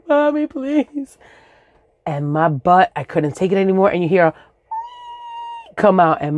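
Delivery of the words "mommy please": put-on high, slow little girl plea; begging kid. Girl begging her mother, high slow plea. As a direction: beg mommy sweetly. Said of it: "I was like".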